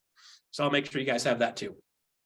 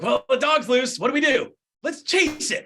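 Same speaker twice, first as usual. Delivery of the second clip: much higher and louder, frantic; excited chase; 8 semitones higher, 5 dB louder than usual. Retold or imitated. Agent spotting a loose dog, yelling excitedly high and loud. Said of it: "You're like".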